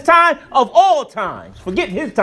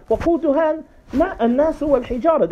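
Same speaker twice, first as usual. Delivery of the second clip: quick Quranic recitation; 7 semitones higher, much faster than usual. Acted. تلاوة سريعة. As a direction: rattle off the verse fast in Arabic.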